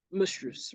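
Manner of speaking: "mistress" with "mis" squashed to a weak, short "muhs"; soft, reduced "muhstruhs" for "mistress"; first syllable cut short. Shown as a demonstration. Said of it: "no way for you to say like".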